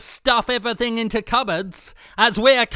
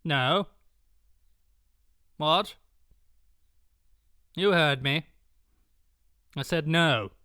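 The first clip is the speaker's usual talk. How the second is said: low, cold refusal; cold villain; 6 semitones lower, 6 dB quieter than usual. Acted. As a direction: drop into a cold menace.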